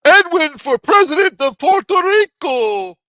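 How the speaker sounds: high, loud, slow announcer call; mock announcer. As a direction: proclaim it like a rally announcer.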